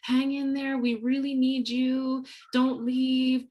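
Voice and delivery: mock urging. Imitating colleagues urging her to stay.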